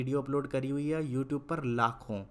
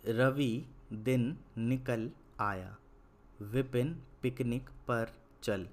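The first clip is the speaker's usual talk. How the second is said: slow read-aloud; slower than usual. Shown as a demonstration. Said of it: "एक बार मैं फिर से सभी चीज़ों को पढ़ देता हूँ".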